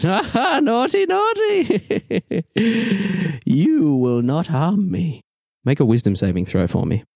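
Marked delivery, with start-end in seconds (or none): 0.0-5.2 high, slow taunting sing-song; the sorceress taunts the disarmed player; mocking sorceress. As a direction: tease him like a naughty child. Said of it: "and she says".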